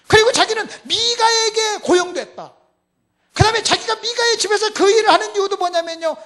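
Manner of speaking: high, loud, impassioned sermon cry; thundering pulpit delivery; full-force preaching. Real, not acted. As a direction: drive the point home to the congregation at full volume.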